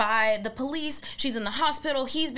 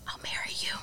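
whispering; 7 dB quieter, faster than usual. Retold or imitated. Victim's resigned answer in story.

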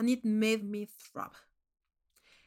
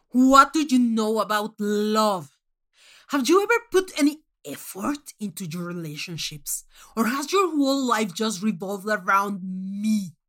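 harsh loud interrogation; 7 dB louder than usual. Acted. Manx on his throne confronting the kneeling contestant, loud and cutting. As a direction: tear into her relationships.

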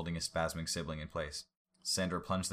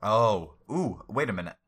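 excited realization; 4 semitones higher, 10 dB louder than usual. Real, not acted. Player realizing something.

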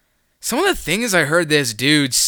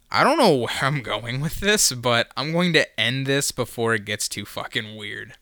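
usual speaking voice

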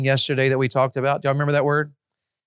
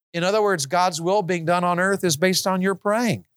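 ordinary delivery

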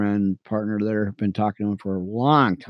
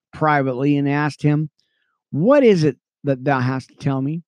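earnest Bible reading, lifting on names; steady scripture read; 5 semitones higher, 4 dB louder than usual. Acted. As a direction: read it like a preacher to his radio friends. Reads scripture.

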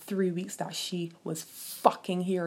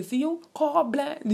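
neighbor's retort; 8 semitones higher, 4 dB louder, slower than usual. Retold or imitated. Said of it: "somebody tell she".

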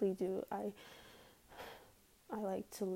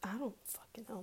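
whispered aside; 2 semitones higher, 8 dB quieter, faster than usual. Real, not acted. Exasperated aside to viewers.

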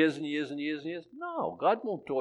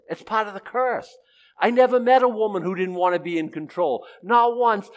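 ordinary delivery

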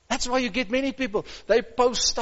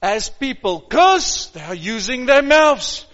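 normal talking voice

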